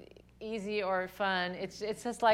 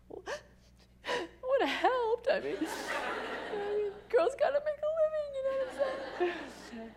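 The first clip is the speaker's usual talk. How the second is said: tearful whine. Retold or imitated. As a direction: plead your case through sniffles and giggles. Replying to God, laughing through tears.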